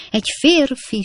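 high, restrained narrator's shriek; terrified shriek. A narrator's reading. Lady discovers a man in her room, terrified shriek. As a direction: cry it out high in fright. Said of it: "sikította a hölgy rémülten".

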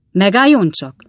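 loud, serious command to wait; grave, forceful interjection. A narrator's reading. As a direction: stop them firmly and in earnest. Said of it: "mondta Pickwick úr komoly hangon".

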